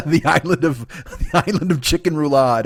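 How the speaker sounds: real laughter breaking into the words; laughing. Real, not acted. Host amused by his island of chicken roulade joke, laughing. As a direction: crack up at your own joke.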